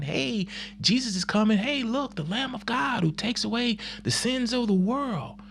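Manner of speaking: high, excited herald call; proclaiming. Retold or imitated. John the Baptist telling everyone, a high excited call. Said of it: "going around telling everyone".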